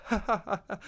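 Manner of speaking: high, loud mock belly laugh; imitated belly laugh. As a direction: imitate someone bursting out laughing. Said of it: "had the biggest belly laugh".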